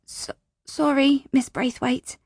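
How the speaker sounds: put-on high, stammering child's apology; startled child. A narrator's reading.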